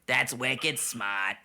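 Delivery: slow, hammed-up Boston accent; stretched-out mock Bostonian. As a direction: lay the Boston accent on thick. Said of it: "you're from Boston".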